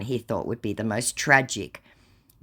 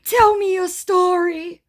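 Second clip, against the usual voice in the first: scammer voice; 7 dB louder, slower than usual. Retold or imitated. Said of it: "To which he responds with".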